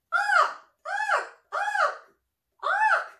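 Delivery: very high, loud raven honks; squawking baby raven calling to the farmer; yelling raven. Acted. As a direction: yell like a baby raven on the ground. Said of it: "Mac was yelling".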